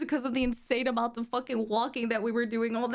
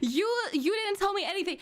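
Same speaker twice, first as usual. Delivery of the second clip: fast protest; 5 semitones higher, 3 dB louder, faster than usual. Real, not acted. S1 protesting S0's account.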